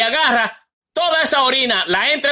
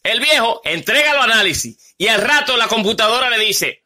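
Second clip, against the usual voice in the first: usual speaking voice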